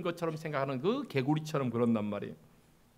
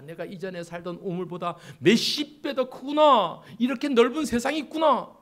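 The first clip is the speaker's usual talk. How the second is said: rising to high, loud wonder; amazed discovery; 6 semitones higher, 8 dB louder than usual. Acted. Frog reaching the stream from its well, amazed. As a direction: marvel as the world suddenly opens up.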